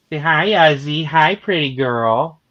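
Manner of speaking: high, drawn-out pet voice. Real, not acted. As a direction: coo at the cat with stretched vowels. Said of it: "in a baby voice".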